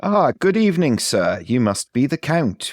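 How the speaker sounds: formal restaurant waiter voice; posh waiter. Acted. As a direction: greet the guest like a maître d'. Waiter greeting the Count.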